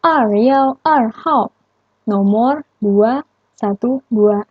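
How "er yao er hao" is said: er yao er hao read higher and slowly; drawn out and higher; slow number reading. Shown as a demonstration. Teacher reading the number for students, slow and higher.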